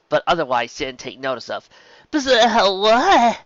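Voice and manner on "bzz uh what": high, loud startled yelp on waking; a filly snapping awake, loud and high. Acted. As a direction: jerk awake and blurt it out. Scootaloo jolted awake in class.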